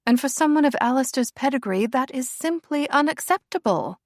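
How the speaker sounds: high, cool and disdainful royal voice; haughty princess. A narrator's reading. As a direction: look down your nose at her.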